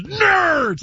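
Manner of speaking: high, drawn-out mock yell; imitated high-pitched holler; Ogre's battle cry. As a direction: yell it like the movie catchphrase. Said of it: "The Taisha goes".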